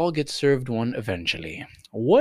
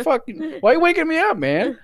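high, loud mock whine; exaggerated outraged complaint of a woken cat; pissed-off cat; 5 dB louder than usual. Host voicing the cat woken yet again, mock-angry outburst. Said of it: "she just gets pissed off".

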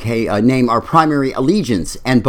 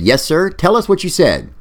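bubbly DJ; 7 semitones higher than usual. Acted. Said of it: "the bubbly D J said".

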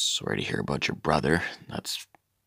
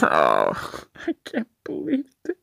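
high, breaking sobbed whisper; crying. Acted. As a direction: choke up over winning a hundred dollars. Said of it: "Bitch, are you crying?".

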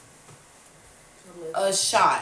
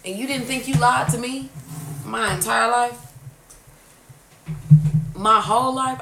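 raised, insistent challenge with pauses; getting for real; 4 semitones higher, 6 dB louder than usual. Retold or imitated. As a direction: press the accusation in earnest, short of shouting. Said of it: "then I started getting for real".

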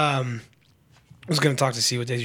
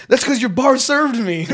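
put-on high, loud drunk comeback; mock indignant yell; 9 semitones higher, 5 dB louder than usual. Drunk traveler blames airline staff's bar, loud high mock protest. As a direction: fire back like an outraged drunk. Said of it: "we could hear him yelling".

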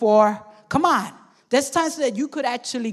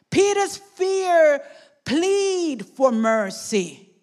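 ordinary talk, nothing marked

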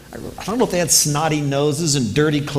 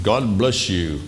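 lower, slow pompous pronouncement; stiff formal blessing; 5 semitones lower, slower than usual. Retold or imitated.

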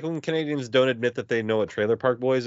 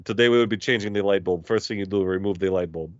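put-on light Russian accent, tutorial tone; a Russian accent, hinted at; 4 semitones lower than usual. Friend imitating Russian YouTube mechanic, light Russian accent. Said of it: "this Russian dude".

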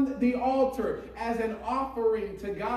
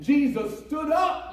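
high, ringing pulpit shout; forceful, raised preacher voice; impassioned preaching; 5 semitones higher than usual. Real, not acted. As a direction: proclaim it to the whole church.

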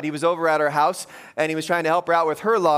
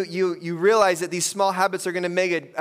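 ordinary talk

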